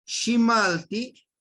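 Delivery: shimalti stretched slow and loud; teacher stressing shimalti slowly; emphatic model word. Shown as a demonstration. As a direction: lean on shimalti, drawn out. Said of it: "bañarse".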